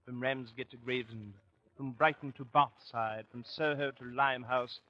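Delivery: put-on lightly done, quick English gentleman accent; mock English accent. [name] reminisces about London, light mock English accent. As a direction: rattle off the English place names in an English accent. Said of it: "What's wrong with my English accent?".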